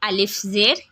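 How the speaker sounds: slow, drawn-out chanted letter drill; teacher's call-and-repeat reading of Arabic letters; Qaida drill recitation. Shown as a demonstration. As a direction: recite each syllable slowly for pupils to repeat.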